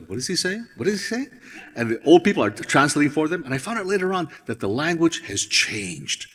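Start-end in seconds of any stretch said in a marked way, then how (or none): 0.1-1.3 confused younger listeners. Retold or imitated. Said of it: "people in the thirties and forties are going".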